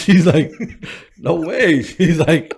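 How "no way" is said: high, loud mock disbelief; imitating disbelieving mom. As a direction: play an incredulous mother, pitched way up. Mom's disbelief, retold.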